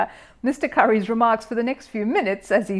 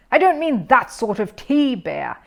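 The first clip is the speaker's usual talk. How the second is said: higher, louder irritated growl; 4 semitones higher, 7 dB louder, slower than usual. A narrator's reading. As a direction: growl through a fixed smile. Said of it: "he growled".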